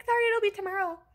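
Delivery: high, breezy imitation of a clerk; mock an unbothered pharmacy tech; unconcerned clerk. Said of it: "super not concerned".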